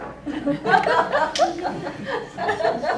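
cracking up at the son; high burst of real laughter. Real, not acted. Mom laughs at son's question.

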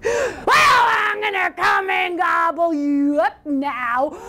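slow, drawn-out roaring troll shout; high-pitched, stretched troll bellow; troll's roar. Acted. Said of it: "roared the troll".

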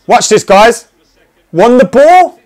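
high, loud disbelieving yell; incredulous shout. Real, not acted. Gamer yelling in disbelief at the screen.

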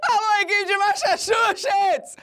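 high, slow cry through laughter; laughing shout. Real, not acted. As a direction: yell while cracking up.

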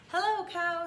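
high, cheery duck hello; Duck's greeting. A narrator's reading. Duck greeting Cow from his bike, high and chirpy. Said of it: "said Duck".

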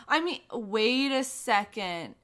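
normal talking voice

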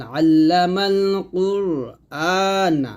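slow, measured chant; teacher's careful tajweed model; Quran recitation. Shown as a demonstration. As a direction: recite it syllable by syllable, slowly. Recites the verse for learners.

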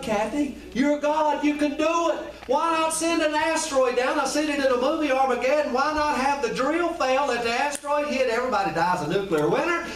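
ordinary talk, nothing marked

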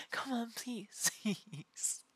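whispered plea through laughter; breathless whisper. Real, not acted.

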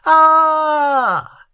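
long high loud scream; sentry screaming as he is overpowered; prolonged scream. A narrator's reading. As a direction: scream as you go down. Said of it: "terminating in a prolonged scream".

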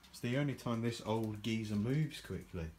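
ordinary talk

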